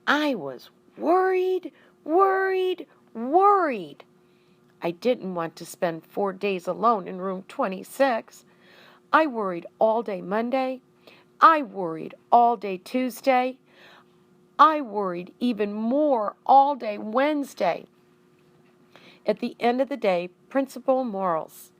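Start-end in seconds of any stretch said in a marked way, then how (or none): 0.1-4.0 higher and louder, each worried rising; fretting, with a ringing echo; anxious hamster echo; echoed worry. A narrator's reading. Hamster echoing anxiety. 9.1-17.9 louder and higher, building each day; mounting anxious narration; building worry. A narrator's reading.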